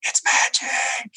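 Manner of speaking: high, slow, breathless whisper of magic; mock whisper. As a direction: whisper it like a secret trick. Kid whispering "it's magic.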